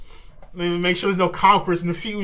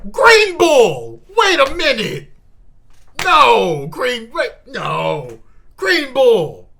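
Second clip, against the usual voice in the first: real hype, much louder shouts; excited yelling; 6 semitones higher, 8 dB louder, slower than usual. Real, not acted. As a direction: scream the name in disbelief. Reacting to Green Bull appearing.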